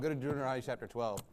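fast murmured repeat. Real, not acted. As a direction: repeat it low to yourself. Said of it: "repeated instruction".

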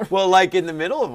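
amused, laughing high and loud; real laughing. Real, not acted. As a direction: burst of laughter, then loud, high, quick words. Teacher amused by student's remark.

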